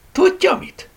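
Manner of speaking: loud, high, suddenly lively; animated, eager pitch jump; perking up. Acted. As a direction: brighten with a new idea. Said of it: "élénkült meg a lány".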